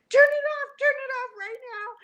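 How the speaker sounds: high, loud panicked scream; retold scream. Screaming at husband to turn off The Shining, high and loud. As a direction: shriek at him to stop the film. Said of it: "I screamed to my husband".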